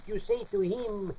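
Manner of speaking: ordinary talk, nothing marked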